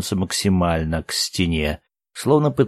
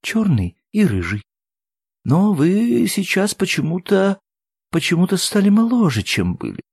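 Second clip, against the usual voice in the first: mildly tearful, high and hesitant; 10 semitones higher than usual. A narrator's reading. Woman puzzles over men grown younger, mildly tearful. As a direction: falter through it, holding back tears. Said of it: "она всхлипнула".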